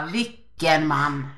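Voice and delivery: mock admiration. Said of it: "Och då tänkte jag bara".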